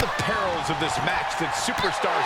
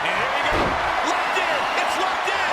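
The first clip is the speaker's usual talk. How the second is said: wrestling commentator; 7 semitones higher than usual. Real, not acted. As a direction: hype the hold as it locks. Excited submission call.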